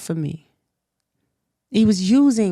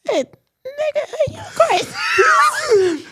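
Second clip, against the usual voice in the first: high, slow incredulous half-shout; retold outrage; much slower than usual. Retelling her reaction to his lie, incredulous yell. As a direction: call him crazy in disbelief. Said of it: "I said".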